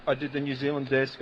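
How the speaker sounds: usual speaking voice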